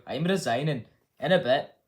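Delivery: loud attempt at a Scottish accent; Nicola Sturgeon. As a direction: put on a rough Scottish accent. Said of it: "do a Scottish accent".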